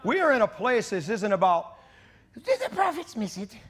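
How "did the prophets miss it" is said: high-pitched put-on British voice; British accent. Preacher mimics doubters of the prophets, mock British accent. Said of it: "I'm doing my greatest British accent".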